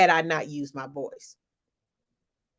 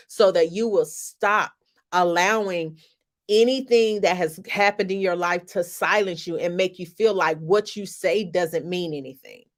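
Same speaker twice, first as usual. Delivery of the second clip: ordinary talk, nothing marked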